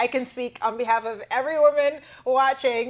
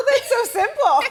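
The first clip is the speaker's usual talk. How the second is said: laughing; 11 semitones higher, 7 dB louder than usual. Real, not acted. Host laughs at simple answer.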